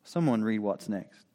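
ordinary delivery

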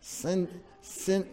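ordinary talk, nothing marked

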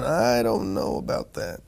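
low, soft inner hesitation; wary doubt. Retold or imitated. Voicing the spirit's unease at someone's Bible truth, wary doubt. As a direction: draw it out quietly, unconvinced.

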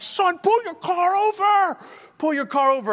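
snarling officer. Retold or imitated. Preacher imitating angry trooper ordering him to pull over, high snarl. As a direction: bark it like a furious state trooper. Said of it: "this guy's snarling".

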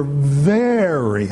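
"very" stretched long and slow; heavy stress on very. Real, not acted. Preacher stressing to the congregation how serious they were, "very" drawn out. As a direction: draw out "very" for heavy emphasis. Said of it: "I cannot emphasize the word very enough".

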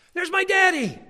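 put-on high, loud child's cry of joy; little girl's delight. Granddaughter spotting her daddy's photo, high and thrilled. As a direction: squeal it like a small girl seeing her dad. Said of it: "she'd go".

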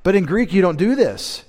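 ordinary delivery

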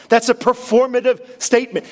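normal talking voice

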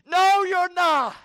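shouted retort. Acted. Preacher answering the sailors for God, loud slow shout. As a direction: slam it back at them, drawn out.

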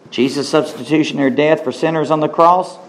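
usual speaking voice

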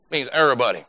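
"everybody" in a South Augusta accent, said low as "ehr-ee-body" with the v dropped. Shown as a demonstration. Preacher jokes to the congregation about local speech, South Augusta accent on "everybody". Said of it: "That's how they say it in South Augusta".

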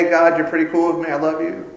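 quick, offhand pretend prayer; mock casual. Preacher imitating a casual believer talking to God, mock offhand. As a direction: toss it off as a breezy, half-hearted endearment. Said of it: "Casually coming in and out".

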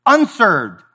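ordinary delivery

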